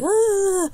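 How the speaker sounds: sudden high squeal of excitement; short, loud, high, mock-excited shriek. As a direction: squeal like a thrilled friend. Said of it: "she's, like, so excited".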